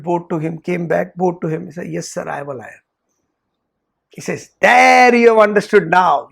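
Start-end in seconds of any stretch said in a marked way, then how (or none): 1.9-2.8 humble admission. Retold or imitated. Student humbly bowing to teacher. 4.6-6.3 emphatic approval. Retold or imitated. Teacher approving the student.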